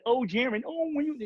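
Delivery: high, mocking whine of a complainer; whiny critic. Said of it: "And if anyone say".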